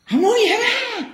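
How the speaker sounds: very high, strained sulky spirit voice; possessed spirit voice. Acted. Possessing dog spirit answering the Mother sulkily, very high-pitched.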